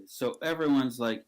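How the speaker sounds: ordinary talk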